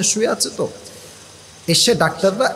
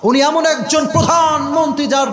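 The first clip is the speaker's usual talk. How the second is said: high, ringing oratory; preaching at full force; 7 semitones higher than usual. Real, not acted. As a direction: proclaim to a crowd and demand assent. (জোরালো ওয়াজের উচ্চকণ্ঠ)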